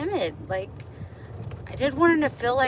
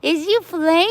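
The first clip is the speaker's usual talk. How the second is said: high cutesy question, quicker; 9 semitones higher, 10 dB louder than usual. Real, not acted. Asking the cat again if it plays. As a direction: ask the kitty again, squeaky. Baby talk repeated.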